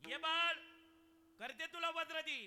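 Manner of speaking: loud, high sermon cry; full-force preaching. Acted. Kirtankar telling the birth story, loud preaching. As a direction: preach it out to the back row.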